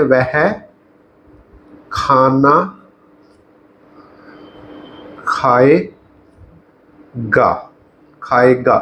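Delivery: word by word with long gaps; slow dictation. Shown as a demonstration. Teacher writing out the future example, slow dictation. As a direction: pace it to a writing hand. Saying words while writing them out.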